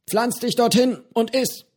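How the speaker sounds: höher, befehlend wie ein Schiffskapitän; Kapitänston. A narrator's reading. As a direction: knapper Befehl eines Kapitäns an den Schiffsjungen. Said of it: "in einem Ton, als wäre er der Kapitän eines Schiffs". (Johnny befiehlt Petro wie ein Kapitän zu essen)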